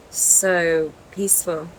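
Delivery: ordinary delivery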